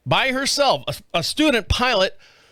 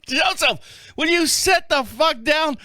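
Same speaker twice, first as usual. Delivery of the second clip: higher, slow, shouted order; yelling at the pilot; 7 semitones higher, slower than usual. Retold or imitated. Copilot ordering the tripping pilot to sit, mock shout. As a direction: bark it at him from across the cockpit.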